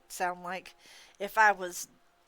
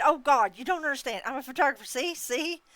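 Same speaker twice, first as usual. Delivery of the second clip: higher, louder frantic pleading; panicked babbling; 6 semitones higher, 7 dB louder than usual. A narrator's reading. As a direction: babble it out, caught red-handed. Panicked photographer caught.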